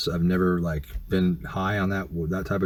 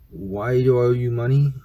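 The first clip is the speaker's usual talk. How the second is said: higher, incredulous pitch rising to the end; retold incredulous question; 5 semitones higher, 3 dB louder than usual. Man retelling his reply to her money demand, incredulous. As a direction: ask it in mock disbelief.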